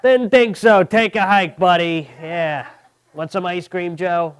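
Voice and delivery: high, loud, territorial father; jealous dad. Retold or imitated. Dad confronting two fans at his son's show, high and loud. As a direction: puff up and chase off the rival fans. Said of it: "my dad will pop up out of nowhere and go".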